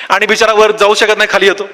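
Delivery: usual speaking voice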